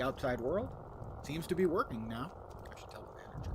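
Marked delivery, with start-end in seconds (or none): 2.7-3.5 a quick private whisper; hushed note to self; whispered aside. Real, not acted. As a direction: whisper it to yourself.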